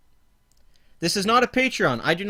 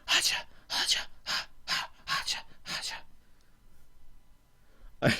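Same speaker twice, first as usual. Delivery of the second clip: breathy villain cackle; 10 dB quieter, faster than usual. Acted. Villain laugh in skit.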